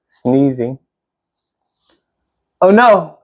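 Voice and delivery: ordinary delivery, nothing marked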